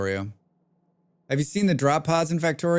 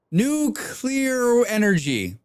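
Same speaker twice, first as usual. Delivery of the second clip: normal talking voice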